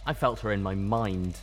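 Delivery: ordinary delivery, nothing marked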